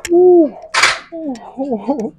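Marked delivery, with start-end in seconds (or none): 0.1-0.6 high, loud gasp of effort; pained whoop. Real, not acted. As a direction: cry out at the burn. Burn cry.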